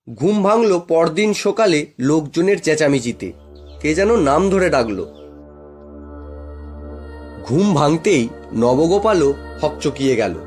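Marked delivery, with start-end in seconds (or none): none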